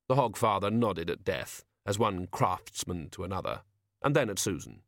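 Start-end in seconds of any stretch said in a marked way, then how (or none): none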